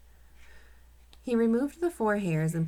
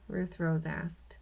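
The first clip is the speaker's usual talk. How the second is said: ordinary delivery, nothing marked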